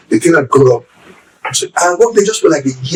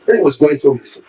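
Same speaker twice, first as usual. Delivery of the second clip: usual speaking voice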